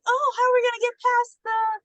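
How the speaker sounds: high-pitched mock worry; scheming girls. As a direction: imitate girls fretting over a plan. Girls scheming, voiced in retelling.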